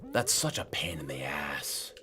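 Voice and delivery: low, voiceless whisper of annoyance; whispered grumble. Real, not acted. Streamer complaining to self about the bug, whispering. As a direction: grumble it under your breath in a whisper.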